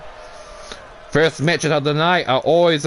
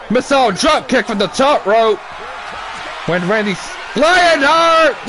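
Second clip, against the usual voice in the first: excited play-by-play; 5 semitones higher than usual. Acted. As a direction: call the move like a ringside announcer.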